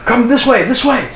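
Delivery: high-pitched urgent call; high yell, not at full volume; yelling to the group. Acted. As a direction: call the party over, voice pitched right up. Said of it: "she yells to you guys".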